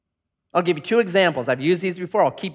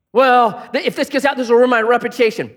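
higher and louder, anxious about reputation; fretting self-talk; 7 semitones higher, 6 dB louder than usual. Acted. Preacher voicing a worried proud heart, fretful. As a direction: panic over being found out.

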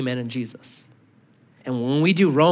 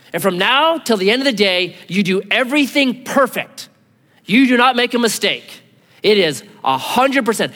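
louder, higher, strict demanding father; demanding dad; 6 semitones higher, 5 dB louder than usual. Retold or imitated. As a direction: lay down impossible rules like a strict dad. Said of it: "If I said".

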